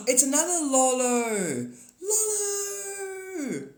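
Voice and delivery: high, slow, delighted call of the name; excited. Real, not acted. Streamer thrilled to greet a new subscriber by name. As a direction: gasp then cry out the name with glee.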